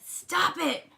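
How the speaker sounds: high, loud command; dad crying out. A narrator's reading. Dad amid flying popcorn, high loud order. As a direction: shout sharply to halt the mess. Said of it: "cried Dad".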